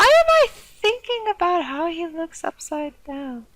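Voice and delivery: musing to herself as the pigeon; character voice. Acted.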